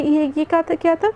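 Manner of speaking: ordinary delivery, nothing marked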